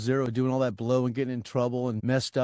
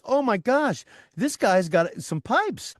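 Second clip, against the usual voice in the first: higher-pitched, excited imitation of an awed fan; mock awe, pitched up; amazed crowd reaction; 8 semitones higher, 3 dB louder, much slower than usual. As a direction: gush like a stunned concertgoer. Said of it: "the crowd's going crazy".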